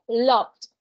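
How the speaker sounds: "Loved" ending on a clear D sound; clear final D. Shown as a demonstration. Teacher showing students the final D in "Loved". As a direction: say "Loved" and let its final D be heard. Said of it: "¿Escuchan esa D?".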